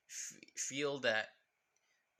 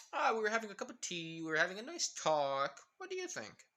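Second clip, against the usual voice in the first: sarcastic; 6 semitones higher than usual. Acted. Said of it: "Pretty much being sarcastic".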